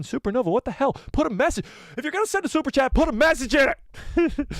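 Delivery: high, exasperated playful rant; mock outraged scolding. Mock scolding of message-less donor.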